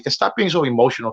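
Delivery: normal talking voice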